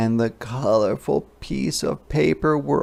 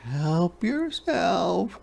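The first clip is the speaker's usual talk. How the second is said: higher, slow little voice; higher slow hedgehog; hedgehog offering; 8 semitones higher, 3 dB quieter, much slower than usual. A narrator's reading.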